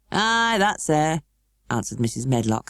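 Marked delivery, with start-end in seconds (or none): 0.1-1.2 slow, curt Yorkshire reply; Yorkshire accent. A narrator's reading. Housekeeper answering the station master in Yorkshire. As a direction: drop into Yorkshire, brief and offhand. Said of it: "speaking with a Yorkshire accent herself".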